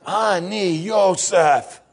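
very slow, drawn-out Hebrew words; Joseph revealing himself. Acted. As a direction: let each syllable land, slowly. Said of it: "First time they heard Hebrew words".

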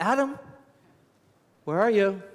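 high calling "Adam", voice lifted; God calling Adam, searching and gentle. Acted. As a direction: call out into the garden.

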